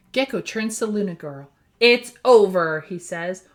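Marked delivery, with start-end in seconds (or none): 1.8-2.8 firm. Acted. Hero confronts villain.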